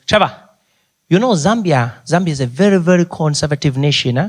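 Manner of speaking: put-on Zambian-accented chaperone; Ali Nani. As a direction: advise earnestly in a Zambian accent. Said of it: "His name was Ali Nani".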